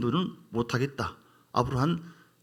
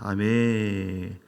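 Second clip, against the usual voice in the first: slow, stretched amen; drawn-out amen; 5 semitones lower, much slower than usual. Real, not acted. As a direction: hold the amen long. Amen after the psalm. (길게 늘인 아멘)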